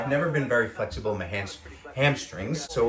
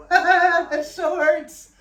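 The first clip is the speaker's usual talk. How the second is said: high, very slow pained laugh-cry; stretched-out high whimper that it hurts; 8 dB louder, much slower than usual. Real, not acted. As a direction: laugh through real pain, drawing it out.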